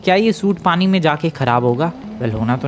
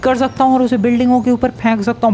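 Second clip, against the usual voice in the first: amazed; 9 semitones higher than usual. Real, not acted. Amazed at the suit's power.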